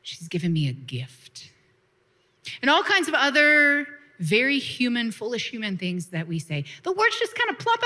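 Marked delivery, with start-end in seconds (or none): 0.0-1.5 low, hushed and dropping to a whisper; Jesus answering gently, fading to a whisper; soft Jesus. Retold or imitated. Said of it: "And Jesus says".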